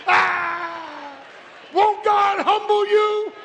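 high, loud, slow whoop; preacher at full force; preaching whoop. Real, not acted. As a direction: hammer home God humbling you.

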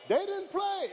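preacher's climbing echo of the point; high shout. Real, not acted. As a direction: lift the repeat way up high and let it fall. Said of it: "preacher repeats line, second take".

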